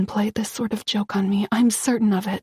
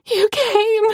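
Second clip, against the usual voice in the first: much higher, bursting with relief; relieved cry; 12 semitones higher, 3 dB louder than usual. A narrator's reading. Rescued from the intruder, she cries out to Dmitri in relief. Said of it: "I cry".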